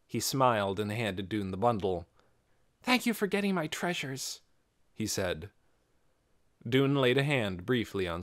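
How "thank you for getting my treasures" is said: put-on grateful young boy. Scargo grateful.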